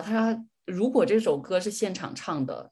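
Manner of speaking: normal talking voice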